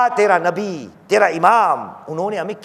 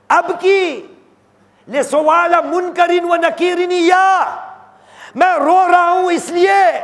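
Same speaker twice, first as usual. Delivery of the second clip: high, ringing retold lament; sustained high-pitched fervent plea; impassioned preaching; 13 semitones higher, 6 dB louder than usual. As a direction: build to an anguished pulpit cry. Preaches lament at full force.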